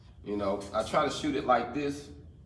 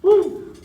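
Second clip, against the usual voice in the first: excited whoop; 10 dB louder than usual. Real, not acted. Cheering after finishing weld.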